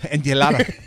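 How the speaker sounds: put-on Spanish pronunciation of enchilada; playful Spanish-accented echo. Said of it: "affect my accent?".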